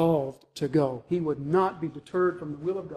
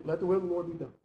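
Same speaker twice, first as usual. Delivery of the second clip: softer, frustrated giving up; pessimistic resignation; 6 dB quieter, much faster than usual. Acted. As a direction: say it as a grudging surrender, no trust in it. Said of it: "I think that's exactly how they said that".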